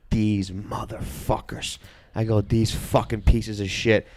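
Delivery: low, slow, quiet cursing; seething under breath; muttered fury. Retold or imitated. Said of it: "and I'm like".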